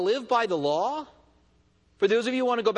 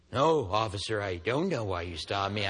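low, soft, playing dumb to the cop; clueless innocent; 12 semitones lower, 5 dB quieter than usual. Driver playing dumb to the officer, soft and innocent. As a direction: innocent driver feigning ignorance. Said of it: "completely clueless".

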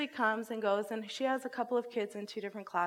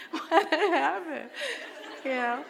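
laughing disbelief; 7 semitones higher, 6 dB louder, slower than usual. Real, not acted. Recalling absurd exchange.

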